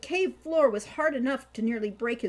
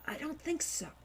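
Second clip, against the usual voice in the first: whisper; 6 semitones higher, 5 dB quieter than usual. A narrator's reading. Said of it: "Hawk whispered back".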